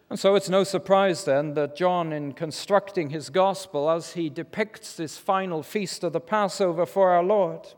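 ordinary delivery

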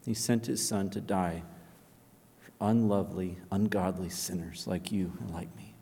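low and soft, sinking toward a whisper; tender appeal. Acted. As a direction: plead gently, almost whispering.

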